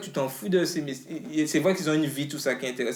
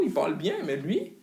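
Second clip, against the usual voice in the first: ordinary talk, nothing marked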